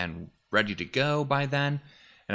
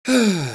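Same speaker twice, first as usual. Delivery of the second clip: real heavy exhale, higher and louder; loud breathless sigh; 12 semitones higher, 10 dB louder than usual. Real, not acted. Winded from the wind outside, a loud sigh. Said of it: "Sorry, I'm a little out of breath".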